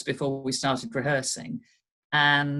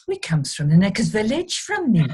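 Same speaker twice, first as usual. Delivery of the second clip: higher, lilting Rhondda Welsh voice; imitated Welsh accent; 5 semitones higher than usual. Welsh teacher reading the author's blurb, imitated Rhondda Welsh accent. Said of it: "I had absolutely the spot on accent".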